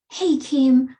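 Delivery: sharp, loud accusing hail; accusing call-out. Shown as a demonstration. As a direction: call her name like an accusation. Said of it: "you're not leading with".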